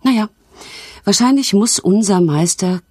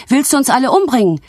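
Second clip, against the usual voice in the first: excited shriek; 9 semitones higher, much faster than usual. A narrator's reading. Saucer panics as the shelf tips, high fast shriek. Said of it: "schrie eine Untertasse aufgeregt".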